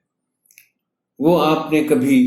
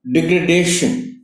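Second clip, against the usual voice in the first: usual speaking voice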